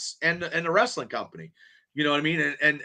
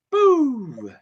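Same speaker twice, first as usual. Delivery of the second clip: a playful, louder pantomime boo; mock boo; 6 semitones higher, 4 dB louder than usual. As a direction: boo it like a heckling fan, half in jest. Booing the announced main event.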